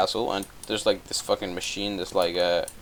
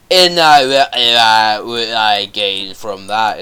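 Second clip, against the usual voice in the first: louder, slower, gruff put-on ogre voice; loud mock Shrek voice; 11 dB louder, much slower than usual. Kid voicing Shrek bargaining with Farquaad, loud put-on voice. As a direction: boom it out like a grumpy ogre.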